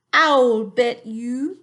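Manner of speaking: put-on a big friendly giant in a funny English accent, drawn out. Teacher voicing the giant for her class, funny accent. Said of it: "give him a funny accent too".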